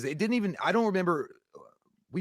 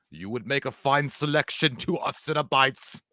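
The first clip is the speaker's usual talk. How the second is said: slow, solemn demon voice; measured, portentous horror-villain impression; Pinhead; slower than usual. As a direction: intone it with grave ceremony. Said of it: "Pinhead might be like".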